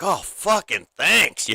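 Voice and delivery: slow, high, drawn-out thanks; Ricky. Retold or imitated. TV character thanking the court, drawn-out and high. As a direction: stretch out an imitated, relieved thanks. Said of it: "he's like".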